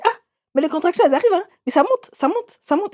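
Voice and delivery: high, fast mock commentary; mother-in-law commenting. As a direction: imitate her, pitch climbing up. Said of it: "Elle dit". (la belle-mère qui commente, voix aiguë et rapide)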